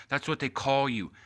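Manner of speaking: usual speaking voice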